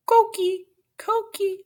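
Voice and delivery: high chirpy coqui call; coqui frog call. Acted. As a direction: squeak it up like a tiny frog. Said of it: "And he says".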